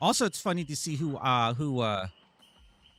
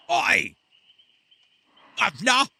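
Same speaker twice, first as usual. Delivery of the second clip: loud, high mock Cockney "Oi! Governor!"; mock British accent; 8 semitones higher, 8 dB louder than usual. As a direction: shout it like a hammed-up London street vendor. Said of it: "how British does it sound?".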